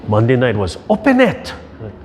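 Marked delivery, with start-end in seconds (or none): 0.9-1.5 put-on high, squeaky little-girl voice; toddler's demand. Grandpa imitating granddaughter's demand, high and childlike. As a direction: play the toddler demanding the freezer open.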